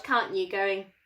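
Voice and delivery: usual speaking voice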